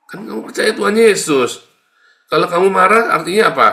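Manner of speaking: loud, high, ringing rebuke; preaching at full force. Acted. Preacher rebuking angry believers, loud and high. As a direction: drive each word at the listeners.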